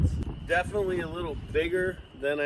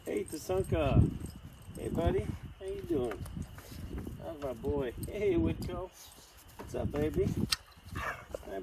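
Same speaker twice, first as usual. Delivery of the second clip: talking to dogs; 13 dB quieter than usual. Real, not acted. Greeting the dogs.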